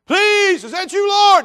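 high, loud plea shouted upward; hollering desperately. Acted. Man on the branch to God, hollering. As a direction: yell up to heaven for help. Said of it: "he's hollering out".

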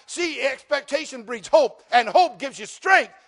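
high, loud declaration of faith, building to a shout; full-force preaching. Real, not acted.

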